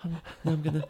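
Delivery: high mock panic, half laughing; panicked whining. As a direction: whine in hammed-up terror. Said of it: "that performance is that".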